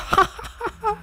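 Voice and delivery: high villain cackle; high-pitched gloating laugh; evil cackle. Pretend. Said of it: "villain laugh".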